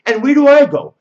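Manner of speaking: sharp high outraged cry. Acted. Bride crying out to the guests, loud high outrage. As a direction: let the question burst out.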